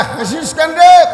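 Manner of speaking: high, loud, drawn-out mock outrage; angry scolding. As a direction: act the indignant owner yelling at a boy. Angry orchard owner scolds laughing boy. (রাগী মালিকের অভিনয়ে চড়া গলায় ধমক)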